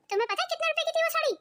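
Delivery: indignant, pitch climbing high on the question; angry accusation. Acted. Sister-in-law confronting elder over a burnt saree, angry. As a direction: accuse her in a rising, outraged voice. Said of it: "तुम इतना गुस्से में क्यों हो".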